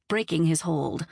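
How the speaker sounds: plain reading voice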